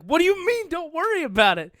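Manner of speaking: very high, loud mock outburst; exasperated disbelief. Daughter to dad over a mishap, exasperated. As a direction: throw it back in loud, squealed alarm.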